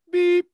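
loud, very high beep; imitated phone beep. Prankster mimics his friend's phone key, high loud beep. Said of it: "Press one beep for yes".

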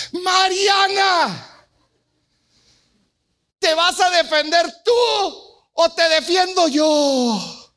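angry shout. Retold or imitated. Interrupted father confronting daughter, angry shout. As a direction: shout the ultimatum at her, slow and hard.

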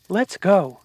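loud and high; urgent shout. Acted. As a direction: call it out urgently. Escaping after door breaks.

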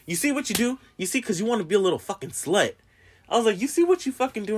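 high-pitched self-scolding rant; scolding self in mirror. Retold or imitated. Speaker scolds herself in the mirror, high mock-angry voice. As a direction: chew yourself out in the mirror. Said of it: "And I'm talking to myself".

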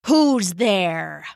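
heavy, very slow called-out question; a lightly voiced brute calling into the dark; slow Orarm challenge. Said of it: "Orarm shouted".

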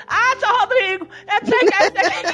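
high, whiny cartoon-voiced housekeeper; Salsicha. Man imitating his housekeeper's excuses, high cartoon voice. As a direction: complain in a squeaky cartoon voice, close to laughing. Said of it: "Ela falava igualzinho o salsicha".

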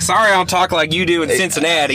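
high, loud mock apology; fake-polite jab back; sarcastic. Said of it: "I'll be like".

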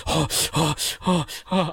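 a retold, acted gasp of fright; mock gasping panic. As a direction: pant it as if just jolted awake. Waking from nightmare, gasps.

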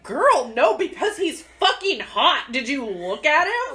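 emphatic retort. Retold or imitated. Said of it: "And I was like".

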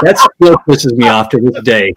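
usual speaking voice

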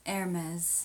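Hermes said as "AIR-mez"; pronunciation demo. Shown as a demonstration. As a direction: drop the H, short e at the end of Hermes. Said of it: "How would you pronounce it?".

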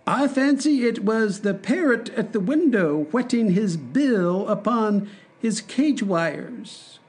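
a light airy courtier. Acted.

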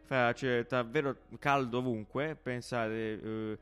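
playful weather presenter voice; weather forecast read. Acted. Mock weather forecast segment.

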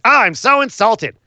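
lightly hammed-up insulted heel; mock offended Seth. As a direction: overplay the hurt feelings.

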